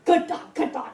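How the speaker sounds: shrill excited praise. Shown as a demonstration. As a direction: gush it at the dog too loudly. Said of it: "like that, I don't think your dog's gonna respond".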